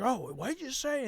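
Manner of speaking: high mock-offended question; imitated indignant challenge. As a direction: mimic an affronted man firing back. Imitates the man's indignant reply.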